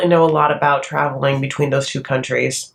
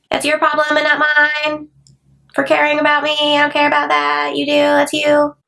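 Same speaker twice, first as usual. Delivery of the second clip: high, whiny mock of a careless daughter; bratty Avery; 10 semitones higher than usual. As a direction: mimic her brushing off mom in a high whine. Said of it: "Avery is just like".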